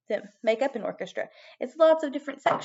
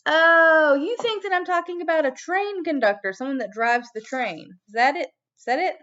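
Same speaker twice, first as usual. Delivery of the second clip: mock surprise; 5 semitones higher, 6 dB louder than usual. Playful surprise at puppet's idea.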